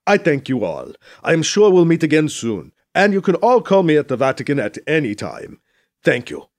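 emphatic, courteous farewell in a character voice; gracious dignitary. Acted. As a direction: thank the room as a statesman. Voices Mbeke taking leave.

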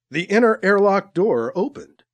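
plain reading voice

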